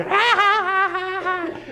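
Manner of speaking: high forced laugh; very high put-on chuckle; mock fake laugh. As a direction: laugh like a nervous client. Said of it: "And you're".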